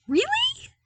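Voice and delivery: very high, loud squeaked disbelief. A narrator's reading. Luke to the sergeant, squeaking in disbelief. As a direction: voice cracks up in shock. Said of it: "he squeaked out".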